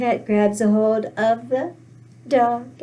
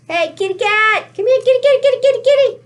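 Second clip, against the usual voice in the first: high, quick kitty-kitty call; calling a cat; 9 semitones higher, 6 dB louder, faster than usual. Acted. Dog calling his kitty cat friend over, high excited call.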